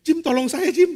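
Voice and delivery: imitated high, loud plea for help; pleading. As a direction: beg a friend in mock panic. Scared friend pleading for help. (memohon tolong dengan panik)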